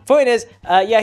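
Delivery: usual speaking voice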